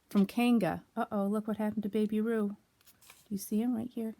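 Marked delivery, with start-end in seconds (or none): none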